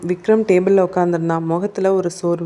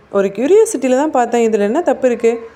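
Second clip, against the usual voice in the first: defensive; 5 semitones higher, 5 dB louder than usual. A narrator's reading. Cornered suspect defends himself.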